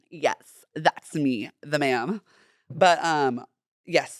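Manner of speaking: ordinary talk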